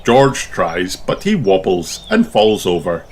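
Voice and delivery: usual speaking voice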